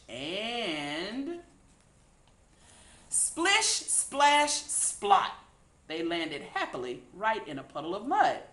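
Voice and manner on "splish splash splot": high, loud splish, splash, then a breathy splot; splashy sound words. A narrator's reading. Reading the pigs' mud landing aloud as sound effects.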